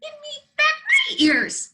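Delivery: very high, loud squeak. Acted. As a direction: squeak it out like a tiny bunny. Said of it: "squeaked the bunny".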